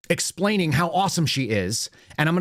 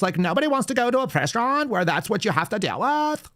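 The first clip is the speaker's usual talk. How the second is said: much higher sneering upspeak; mocking up-talk; 7 semitones higher than usual. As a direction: parody her lilting corporate voice. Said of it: "she just talks like this".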